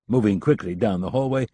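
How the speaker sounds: ordinary delivery, nothing marked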